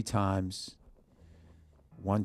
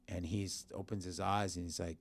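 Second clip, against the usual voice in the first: ordinary delivery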